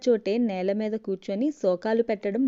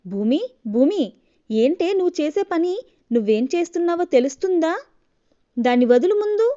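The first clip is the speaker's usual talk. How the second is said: high, loud alarmed shouting; angry shout; 6 semitones higher, 5 dB louder than usual. Acted. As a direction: yell at her to let go. Husband stops wife hurting child. (కోపంగా గట్టిగా అరుపు)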